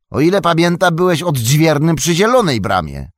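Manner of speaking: high, loud, indignant questioning; loud demand. A narrator's reading. Merry demanding answers at gate. (Merry głośno domaga się wyjaśnień)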